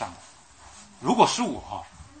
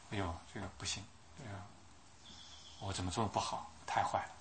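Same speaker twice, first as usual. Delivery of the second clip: remorseful murmur; 5 semitones lower, 14 dB quieter than usual. Acted. Preacher voicing the brothers' guilt, soft remorseful murmur. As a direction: let the guilt sink in, barely above a breath.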